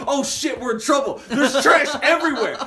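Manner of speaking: high, laughing alarm; light panic over the mess; teen on camera spotting spilled trash; excited mock panic. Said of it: "you hear me on camera going".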